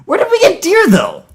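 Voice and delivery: high-pitched baffled outcry; incredulous. Real, not acted. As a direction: demand it high, in utter disbelief. Baffled outburst at the story.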